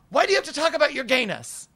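high-pitched mock screech; retold screaming. Woman rounding on the narrator, high mock outrage. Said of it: "like, screaming".

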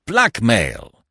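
loud, high, firm; emphatic repeat. A narrator's reading. Stoner repeating his theory, emphatic. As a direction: hammer the word home. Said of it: "repeated Stoner".

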